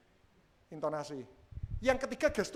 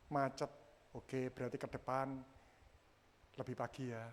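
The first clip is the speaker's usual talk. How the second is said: low, soft, understanding reply; quiet, calm acceptance; empathetic exchange; 8 semitones lower, 11 dB quieter than usual. Shown as a demonstration. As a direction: answer gently without blame. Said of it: "yang satu empati, kepingin menolong".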